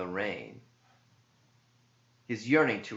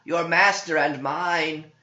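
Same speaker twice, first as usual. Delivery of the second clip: tempting demon; 9 semitones higher, 6 dB louder than usual. Acted. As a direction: lure the priest toward the beast.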